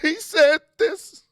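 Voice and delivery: hammed-up whining over what he said; mock flustered complaining. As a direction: play the frustrated voter griping about every quote. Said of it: "be like".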